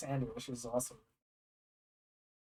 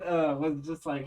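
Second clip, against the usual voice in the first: ordinary talk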